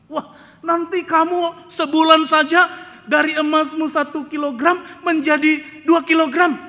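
loud, high sales pitch; smooth salesman. Retold or imitated. Gold company pitching investors, loud eager sales voice. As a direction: hype it like a scam promoter. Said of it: "memberikan sejuta harapan".

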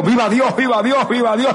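high-pitched repeated cry of praise; re-enacted ecstatic shout. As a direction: cry it out over and over, retelling an outburst. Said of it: "comencé a gritar a toda voz".

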